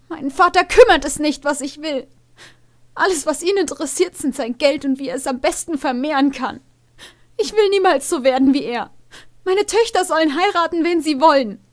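put-on high, loud girlish voice, defiant and tearful; Farah, high and upset; young mare Farah, upset. Daughter protests her father's marriage plans, loud and high.

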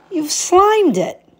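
high, slow, disgusted complaint; disgusted princess. Acted. Princess taking back her slimed ball, high disgusted drawl. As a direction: recoil at the slimy ball.

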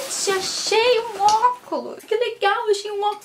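thrilled to have found glasses; excited. Real, not acted. Excited about finding glasses.

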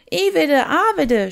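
high, loud, drawn-out shout; children shouting their choice of story, high and loud. A narrator's reading. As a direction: shout out your pick of story. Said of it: "schrien einige".

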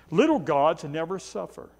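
ordinary talk, nothing marked

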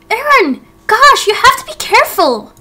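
jump, then scold him; startled scolding. Acted. Startled by friend appearing.

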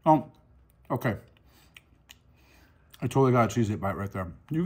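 chewing while talking; mouth partly full. Real, not acted. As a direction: food in mouth. Seen 2: Holds crunchwrap after bite; mouth working between words.

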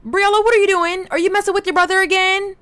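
high, loud telling-off; scolding call. Real, not acted. Player catching Briella messing with her brother, scolding. Scolds a misbehaving game character.

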